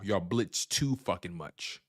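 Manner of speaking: slow, weighty, "too" leaned on; slow emphatic jab. Real, not acted. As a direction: drag out "too fucking much". Hammering the rival's weakness.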